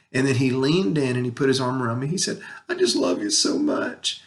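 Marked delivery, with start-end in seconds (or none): 2.7-4.2 retold child's confession, higher and catching; heartfelt. Hurting son leaning in to comfort his dad, heartfelt. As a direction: imitate a hurting boy's tender words, voice lifted high. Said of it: "when he said that, it broke my heart".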